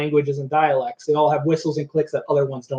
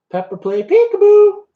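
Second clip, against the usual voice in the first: much higher, louder sing-song coax; high coaxing call; 6 dB louder than usual. Real, not acted. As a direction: coax the parrot in a high, loud voice. Calling the parrot again, coaxing.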